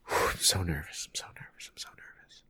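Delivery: sigh, then a fading nervous whisper; nervous inner whisper. Acted. Internal monologue of the guy hoping his shoes get noticed, nervous whisper. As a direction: whisper it to yourself, barely audible. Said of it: "I'm gonna be the internal monologue of the guy".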